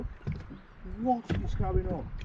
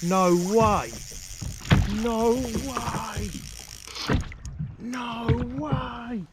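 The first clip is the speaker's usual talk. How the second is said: high, amazed no way; can't believe it, higher voice; excited disbelief; 5 semitones higher, much slower than usual. Real, not acted. Hooked a monster pike.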